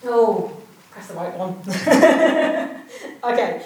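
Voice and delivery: normal talking voice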